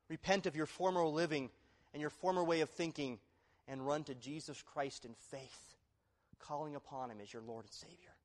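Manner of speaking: normal talking voice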